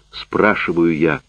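plain reading voice

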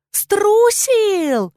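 very high, loud shriek; queen screaming in scorn; yelled taunt. A narrator's reading. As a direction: hurl it out as a high yell. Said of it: "заорала королева".

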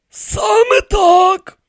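very high, loud, drawn-out; firm confirmation. A narrator's reading. High priest confirms his age, slow and firm.

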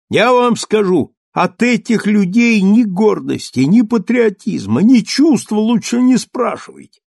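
high, loud indignant tirade; indignant rant. Acted. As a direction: rail at the cowardly crowd. Lieutenant rails at soldiers.

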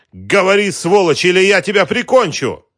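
high, loud, enraged shout; furious threat. Acted. Woman aiming a pistol at the captive, furious shout. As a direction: threaten him at full voice.

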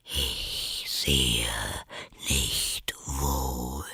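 low, soft, drawn-out voice; sleepy caterpillar. A narrator's reading. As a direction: drone it low and drowsily. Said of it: "mit schläfriger, langsamer Stimme". (tiefe, schläfrige Raupenstimme)

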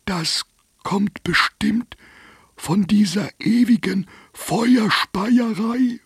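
high, slow, breath-only start then voiced; whiny dragon groaning; sore-throated dragon complaining; whispery dragon. A narrator's reading.

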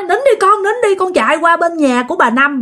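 high, urgent reenacted call; retold shouting. Father to crying child during the commotion, retold urgent shout. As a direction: act out a father urgently sending his child off. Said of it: "mới la làng lên nói".

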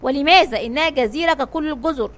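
ordinary delivery

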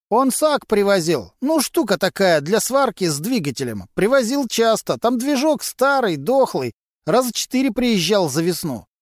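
a sly garage lowlife gossiping to cops; Vasily, a gruff mechanic. A narrator's reading.

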